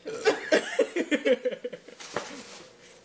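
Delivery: high, breathless laughing fit; hysterical laughter. Real, not acted. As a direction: lose it completely laughing. Bursting into uncontrollable laughter.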